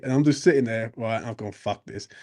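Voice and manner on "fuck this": low, much softer retold thought; muttered aside. Passenger to himself as the inspector asks for tickets, muttered.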